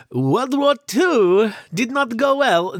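high, slow mock Italian slipping into Russian; botched Italian accent that drifts toward Russian; Italian accent gone Russian. Host's failed Italian attempt, accent turning Russian-y at the end. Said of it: "That, again, kind of Russian-y".

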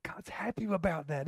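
hushed whispered aside; angels whispering. Retold or imitated. Angels telling each other about God, whispered. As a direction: lean in and whisper the secret. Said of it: "They're going".

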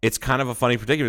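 ordinary talk

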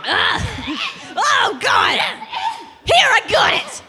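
high, loud victorious yell; triumphant shout. Acted. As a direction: shout it as you grab it. Struggle for bib, shouting.

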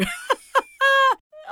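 high, loud burst of laughter; real laugh. Real, not acted. Podcast guest joking about bad video shots, loud high laugh. Laughs at own horror-show remark.

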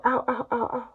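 high, soft little ows; hurting lungs, high and soft; lungs whimpering. Acted. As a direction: whimper in pain like sore lungs. Lungs cry in pain.

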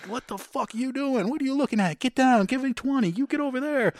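high-pitched retold drill sergeant yell; strained, high imitation of yelling instructors. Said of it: "they're yelling".

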